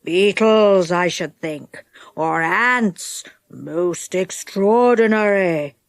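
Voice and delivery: low, drawn-out musing voice; story character musing. A narrator's reading. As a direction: ponder it slowly in a low character voice.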